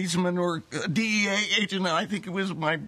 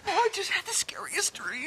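frightened; 11 semitones higher than usual. Acted. Just woken from a nightmare.

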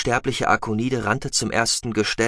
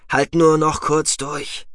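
gentle encouragement; 6 semitones higher, 3 dB louder, slower than usual. Acted. Comforts dying woman.

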